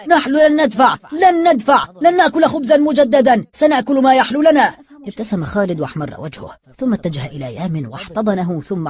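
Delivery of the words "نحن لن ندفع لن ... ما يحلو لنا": higher and louder chant of refusal; loud united defiant cry; crowd shouting. A narrator's reading. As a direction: everyone roars together. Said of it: "صاح الجميع".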